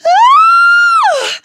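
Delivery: piercing high scream; shriek. Acted. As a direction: scream in terror at the creepy crawly. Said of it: "she shrieked".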